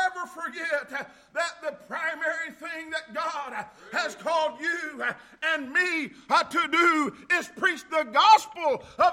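loud whooping preacher cadence, building; full preaching. Acted. Pastor exhorting the congregation in a loud preaching cadence. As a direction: preach it to the church at full force.

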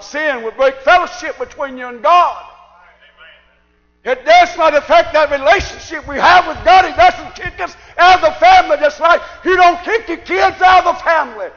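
high, shouted sermon delivery; full-force preaching. Acted. Preacher explaining fellowship and family to church, full-force preaching. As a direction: press the point home at the top of the voice.

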